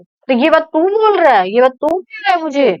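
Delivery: incredulous retort. Acted. Smoker answering a lecturing friend, incredulous retort. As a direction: throw the accusation back, pitch lifted.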